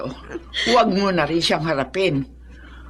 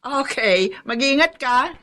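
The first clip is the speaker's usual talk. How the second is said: ordinary delivery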